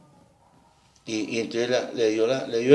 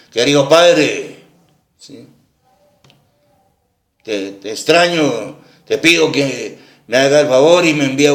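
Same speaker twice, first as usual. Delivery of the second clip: harsh booming reading; 2 semitones higher, 6 dB louder than usual. Retold or imitated. Man reads the son's letter to the old father, loud and rough. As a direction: bark out the son's letter like a scolding. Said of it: "Pero así en voz toda grande, grave, y así todo estoposo".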